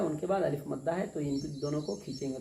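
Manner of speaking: ordinary talk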